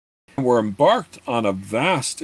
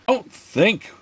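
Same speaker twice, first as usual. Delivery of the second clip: much higher sharp yell; 10 semitones higher than usual. A narrator's reading. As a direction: snap the order out as a yell. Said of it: "both Gord and the wizard yelled at once".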